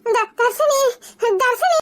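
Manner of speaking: breathless, halting, distressed; panicked stammer. Acted. Girl telling mother of a kidnapping, panicked and breathless. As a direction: struggle to get the news out in panic.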